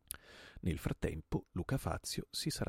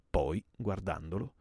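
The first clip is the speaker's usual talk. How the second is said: usual speaking voice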